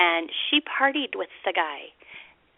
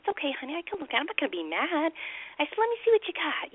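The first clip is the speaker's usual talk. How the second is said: coaxing mommy voice; 8 semitones higher than usual. Retold or imitated. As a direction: sweet-talk her so she's not afraid. Mother coaxing child to show phone.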